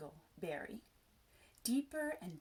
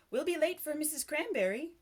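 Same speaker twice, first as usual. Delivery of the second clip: higher, louder urging; urgent reminder; 7 semitones higher, 6 dB louder than usual. A narrator's reading. New friend warning Peter of lateness, urgently. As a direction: hurry a friend along, raised voice.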